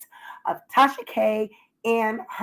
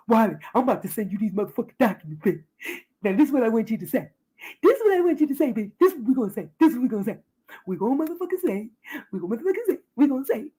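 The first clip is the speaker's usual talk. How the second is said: hammed-up instructions to Wiley; mock Tasha K coaching; much faster than usual.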